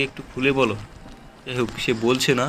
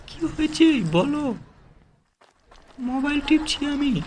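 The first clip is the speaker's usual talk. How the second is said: squeaky character voice; 11 semitones higher than usual. Pretend. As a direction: squeak it out like a cartoon bystander. Voicing Belgian NPC in high voice. (Belgian লোকটির চরিত্রে অনেক উঁচু চিকন গলা)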